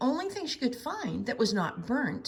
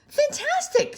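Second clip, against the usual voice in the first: excited praise; 7 dB louder than usual. A narrator's reading. Elizabeth praising in high voice.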